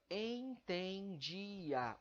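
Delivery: entendia drawn out slowly, en-ten-di-a; syllable by syllable. Shown as a demonstration. Teacher showing how many emissions the word takes, entendia slowly syllabified. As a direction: stretch entendia so each of its four vowels sounds. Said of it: "a quantidade de emissões que eu usei pra falar".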